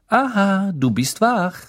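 brisk, high-pitched greeting. A narrator's reading. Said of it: "begrüßte sie ihn forsch".

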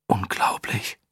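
awed whisper; breathy whisper. Acted. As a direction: breathe it out in awe. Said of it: "hauchte jemand".

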